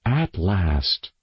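plain reading voice

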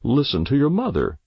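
ordering. Acted. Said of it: "Father ordered".

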